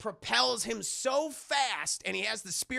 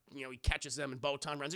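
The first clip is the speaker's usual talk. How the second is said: normal talking voice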